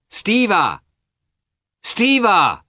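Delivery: high, loud call to her husband; wife calling her husband back, loud and high. Acted. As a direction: call him back down the street. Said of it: "Dolly called and blushed".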